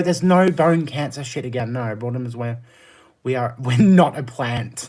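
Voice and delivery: ordinary talk, nothing marked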